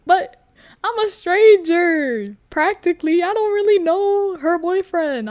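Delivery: high, loud, insistent protest; emphatic self-defence. Real, not acted. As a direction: plead your case, raising pitch and volume. Defends not telling the boyfriend.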